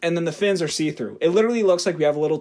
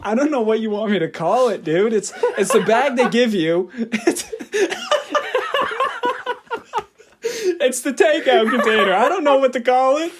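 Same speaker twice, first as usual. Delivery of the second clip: higher, helpless real laughter breaking up the words; laughing protest; 8 semitones higher than usual. Real, not acted. Storyteller teased for saying travel bag, protesting through laughter. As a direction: defend yourself while cracking up. Teased over word, cracks up defending it.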